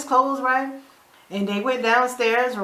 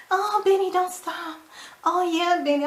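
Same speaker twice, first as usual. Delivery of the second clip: high, soft mock moaning; Melissa; 7 semitones higher, 3 dB quieter than usual. Woman in bed with Benny, mock moaning. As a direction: imitate breathy bedroom moans, hammed-up. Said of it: "Melissa moaning and stuff".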